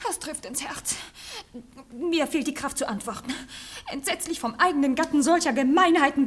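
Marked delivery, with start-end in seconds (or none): 0.1-4.6 breathy hurt whisper, much softer; wife whispering her injured innocence; wounded whisper. Acted. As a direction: sigh it like a stricken lady. Wife plays wounded victim.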